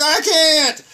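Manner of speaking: high, loud helpless protest; exasperated protest. Retold or imitated. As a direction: plead that it's impossible. Retells his desperate reply.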